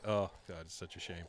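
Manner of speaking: low, hushed mock dismay; dejected. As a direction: murmur it like a head-bowed lament. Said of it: "bows their head like".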